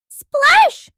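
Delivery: loud playful splash; splash sound. Pretend. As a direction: shout splash like water hitting. Splash sound effect in lesson.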